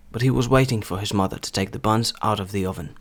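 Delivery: standard British accent; британська вимова. Shown as a demonstration. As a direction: read it in Received Pronunciation British. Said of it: "стандартна британська вимова". (стандартна британська вимова)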